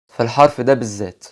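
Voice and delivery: ordinary delivery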